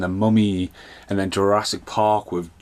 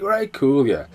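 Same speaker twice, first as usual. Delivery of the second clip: much higher, breezy mock nonchalance; mock casual shrug; 6 semitones higher, slower than usual. Swimmer eyeing the huge pool, breezy mock shrug. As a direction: toss it off lightly. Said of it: "it's like".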